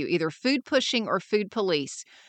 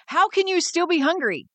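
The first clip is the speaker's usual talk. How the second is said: mock exclaiming; 9 semitones higher than usual. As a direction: exclaim it like a shocked friend. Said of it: "your friend exclaims".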